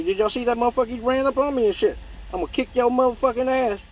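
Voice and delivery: startled outburst. Real, not acted. Startled by something flying at her.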